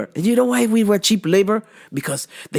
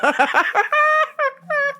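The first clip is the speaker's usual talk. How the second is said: high squealing laugh. Real, not acted. Cracking up at the T'Challa joke, high squeal. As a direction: let a high laugh run out.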